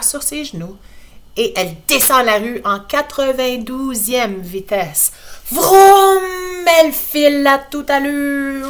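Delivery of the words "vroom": high, loud vroom yell; shouted vroom. Acted. Laurie racing with her brother, shouted vroom. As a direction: roar it like an engine. Speeding to the hospital.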